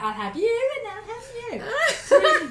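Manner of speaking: high, loud, drawn-out playful call; laughing. Pretend. Choosing slow-dance partners as a joke, laughing.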